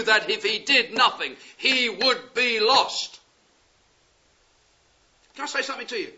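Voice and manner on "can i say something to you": ordinary delivery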